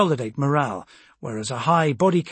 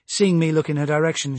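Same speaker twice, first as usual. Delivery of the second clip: ordinary delivery, nothing marked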